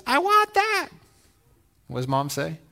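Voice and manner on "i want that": put-on high, whiny child's demand; whiny kid. Kid in the toy aisle begging mom for a toy, high and whiny. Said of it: "and the kid says".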